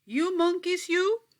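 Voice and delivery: high, loud scolding of the monkeys; scolding peddler. Acted. Peddler scolding the monkeys in the tree, loud and high. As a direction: wag a finger and scold them.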